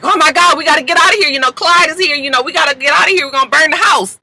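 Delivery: high, urgent mock panic; acted frantic mother raising the alarm. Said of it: "her mom is all frantic".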